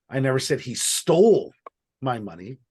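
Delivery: "stole" stressed with a fall. Shown as a demonstration. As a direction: hit "stole" hard. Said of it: "depending on which word I emphasize".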